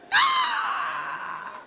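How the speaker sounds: high squealing cry of delight; mock excited shriek. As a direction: shriek it like thrilled brothers. Frères imités, cri aigu. (cri aigu de joie imité)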